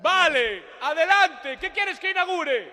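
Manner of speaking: high, very loud hammed-up yell; mock shouting mayor. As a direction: bellow it like a pompous mayor. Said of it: "¿por qué chillamos?".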